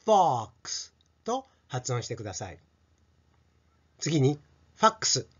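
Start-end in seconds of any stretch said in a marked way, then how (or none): 0.0-0.9 フォックス as English "fox" with an open long vowel; wide-mouthed fox. Shown as a demonstration. Teacher explaining the mouth shape, open English fox. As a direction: drop the jaw on the vowel of フォックス. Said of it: "口を大きく二センチぐらいは開けて".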